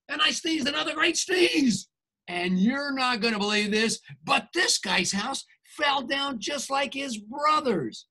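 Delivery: ordinary delivery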